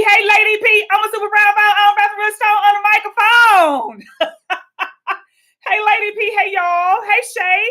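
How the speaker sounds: high, loud hype-man greeting; over-the-top radio shout-out voice; mock fan shout-out. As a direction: ham up an excited caller.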